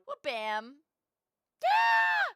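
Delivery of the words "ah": high-pitched anime squeal; cute high squeal. Acted. Alert animation voice squeals.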